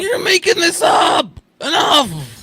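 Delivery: high furious crying yell; angry shout. Acted. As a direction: yell it like you've had enough. Mock outrage at her owl story.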